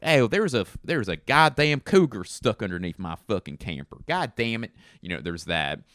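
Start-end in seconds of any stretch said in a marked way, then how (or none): 0.0-4.7 loud, rural Southern twang; Southern accent. Shown as a demonstration. Camper owner reporting a stuck cougar, loud Southern twang. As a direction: exclaim it in a hammed-up country Southern accent. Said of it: "that's one. And then there's like".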